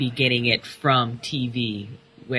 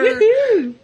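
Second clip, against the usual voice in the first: high whooping cheer; excited whoop; 6 dB louder than usual. Pretend. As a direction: whoop like a fan.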